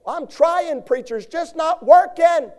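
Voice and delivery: high loud complaining voice; high loud mock lament; whiny excuse. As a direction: mimic a churchgoer whining. Preacher voices struggling congregant.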